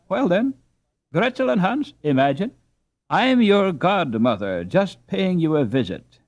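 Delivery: high, lofty pretend godmother; playful fairy godmother. Annie pretending to be a fairy godmother granting wishes. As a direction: play grand fairy godmother to the children. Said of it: "Annie plays fairy godmother".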